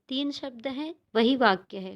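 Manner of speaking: ordinary talk, nothing marked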